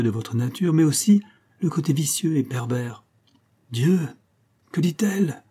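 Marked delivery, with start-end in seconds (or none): 3.7-5.4 high, startled cry of alarm; shocked exclamation. A narrator's reading. Hortense overhearing "vicieux et pervers", shocked high cry. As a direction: gasp it out, scandalised. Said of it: "s'écria Hortense".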